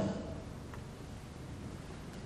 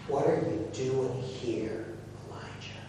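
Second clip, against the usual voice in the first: hushed, barely voiced words; low whisper; 5 semitones lower, 10 dB quieter than usual. A narrator's reading. God speaks to Elijah at the cave, low whisper. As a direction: breathe it like a still small voice. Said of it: "the sound of a low whisper".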